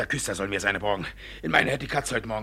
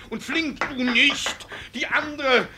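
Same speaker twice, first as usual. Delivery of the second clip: frantic orders; 12 semitones higher, 4 dB louder, slower than usual. Acted.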